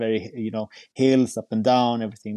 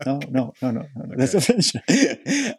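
insisting correction; 2 semitones higher than usual. Retold or imitated. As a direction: hold the last word up, teasingly stubborn. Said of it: "And I was like".

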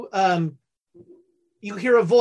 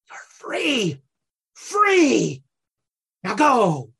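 cat ambassador freeing tigers; 2 semitones higher, 3 dB louder, much slower than usual. Acted. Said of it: "grabs one of the tiger's face and goes".

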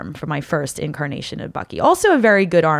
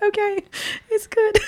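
overcome by cuteness. Retold or imitated. Melting at the child's greeting.

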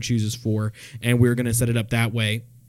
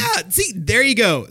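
excited; 7 semitones higher than usual. Real, not acted. Excited at Sky High reference.

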